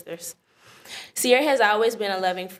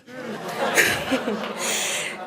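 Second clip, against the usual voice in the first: laugh; 5 semitones higher than usual. Real, not acted. After the speeding ticket story.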